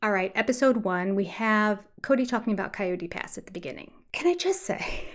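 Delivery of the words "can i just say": laughing exasperation. Real, not acted. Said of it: "I've let it go".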